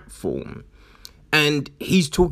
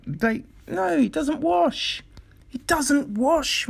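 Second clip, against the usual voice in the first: high exasperated rejection; 12 semitones higher than usual. Real, not acted. Rejecting excuse, high pitch.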